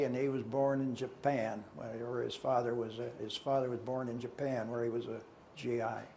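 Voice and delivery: usual speaking voice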